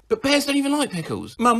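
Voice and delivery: normal talking voice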